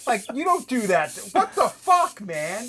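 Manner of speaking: high, loud, stretched-out outrage; mad. Retold or imitated. Tattooist retelling being snubbed by the show's boss, mad. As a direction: retell it in stunned, high-pitched anger. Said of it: "I was so mad".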